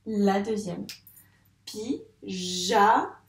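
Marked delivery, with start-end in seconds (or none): 1.6-3.1 "Pyjama" split pi... ja... ma with pauses; teacher separating pi, ja, ma; syllable by syllable. Shown as a demonstration. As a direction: count out "Pyjama" in three slow beats. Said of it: "On entend bien le j dans la syllabe ja".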